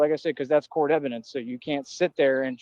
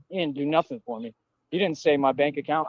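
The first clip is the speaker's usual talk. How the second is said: usual speaking voice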